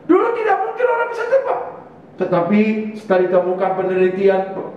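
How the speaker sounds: high, lively sermon voice; preaching. Acted. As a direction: preach it to the church.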